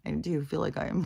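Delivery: ordinary talk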